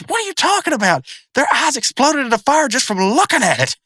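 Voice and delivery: very high, agitated cartoon voice; incredulous cartoon character. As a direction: protest in squeaky mock disbelief. Acting out Rigby's incredulous protest.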